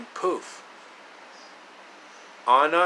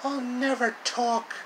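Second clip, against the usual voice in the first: defiant captive; 10 semitones higher than usual. Acted. Captive Anna refusing under torture.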